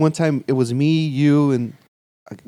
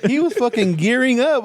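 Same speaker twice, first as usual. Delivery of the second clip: laughing; 6 semitones higher than usual. Real, not acted. Laughing while telling the story.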